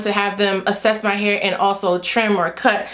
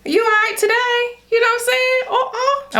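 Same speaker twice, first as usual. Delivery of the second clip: high-pitched Haitian-accented delivery; high, sing-song Haitian lilt; Haitian accent slipping out; 11 semitones higher than usual. Real, not acted. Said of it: "My accent came out".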